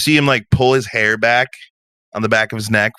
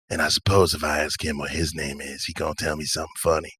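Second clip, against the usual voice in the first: low, soft, knowing character voice; wry stranger, quietly teasing; laid-back drifter, wry; 6 semitones lower, 7 dB quieter, faster than usual. Acted. Stranger teasing about a brooding man's name, wry. Game master voices Patch.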